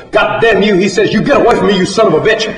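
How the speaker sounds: high, loud cursing; furious scream. A narrator's reading. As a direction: yell it at the charging sow. John panics at the sow.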